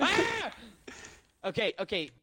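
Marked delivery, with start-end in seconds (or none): 0.0-0.5 sharp startled yelp; sudden yell. Real, not acted. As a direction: yelp out loud.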